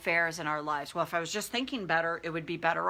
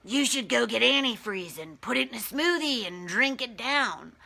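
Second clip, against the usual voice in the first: higher, taunting voice of the inner bully; mocking inner-critic voice, pitched up; demonic bully voice; 4 semitones higher than usual. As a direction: sneer it out like a demonic critic in her head. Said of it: "Does it sound anything like this?".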